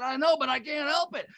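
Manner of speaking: mock helpless protest. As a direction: plead that you just can't help it, half joking. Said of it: "I said".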